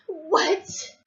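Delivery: high, loud mock shock; a sudden high yelp of disbelief. On hearing North Korea will bomb us, shocked. Reacts to bomb news, retold.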